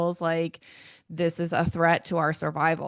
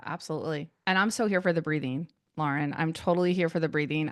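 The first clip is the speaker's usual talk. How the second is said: ordinary delivery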